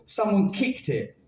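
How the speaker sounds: ordinary delivery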